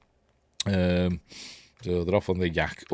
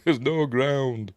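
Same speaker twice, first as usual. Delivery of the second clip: raised, very drawn-out cry; mock exclamation; 5 semitones higher, much slower than usual. Quoting the film at the pit, drawn-out mock cry. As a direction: stretch it into a mock realisation.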